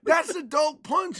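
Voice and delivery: slow, high, loud retold scolding; angry mom. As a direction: hammer it home as an outraged mother. Mom explodes at child.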